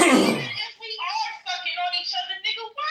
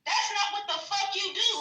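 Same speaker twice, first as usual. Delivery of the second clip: ordinary talk, nothing marked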